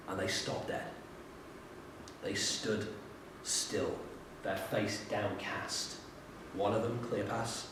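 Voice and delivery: hushed storytelling. A narrator's reading. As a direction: drop to a soft, hushed telling. Dramatic hush in the story.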